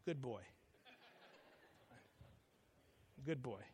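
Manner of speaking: lightly voiced dad, quiet and unhurried; approving dad. Dad in his head praising his son, approving. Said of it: "to hear my dad in my head saying".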